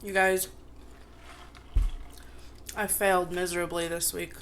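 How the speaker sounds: normal talking voice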